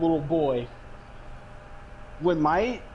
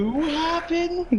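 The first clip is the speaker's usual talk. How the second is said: drawn out high; 9 semitones higher, much slower than usual. Real, not acted. Playful repeat of phrase.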